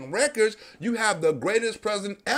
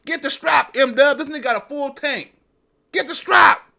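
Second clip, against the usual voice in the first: mock robbery shout; 5 semitones higher than usual. Joking to M Dub about robbing a guy, shouting. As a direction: hype it up until you shout the last line. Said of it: "I was like".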